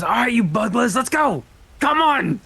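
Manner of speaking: loud, high rallying call; rallying shout. Acted. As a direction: cheer the troops on loudly. Character rallying troops into combat.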